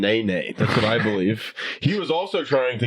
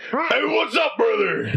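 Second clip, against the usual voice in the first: Hulk Hogan impression; 9 semitones higher, slower than usual. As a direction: shout it like a hyped-up wrestler. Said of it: "that's just Hulk Hogan".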